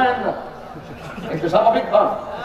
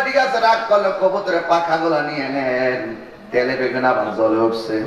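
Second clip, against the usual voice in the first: nephew replying; 8 semitones lower than usual. Retold or imitated. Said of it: "কয়".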